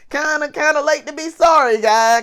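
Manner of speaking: high, loud incredulous retort; exasperated retort. Retold or imitated. Coworker to apologetic guy who exposed them, exasperated.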